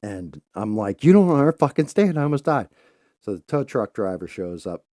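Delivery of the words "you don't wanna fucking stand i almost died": high loud frantic protest; indignant outburst. Retold or imitated. Father protesting to wife he almost died, high and loud. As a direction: blurt it way up and loud.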